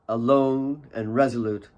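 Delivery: ordinary delivery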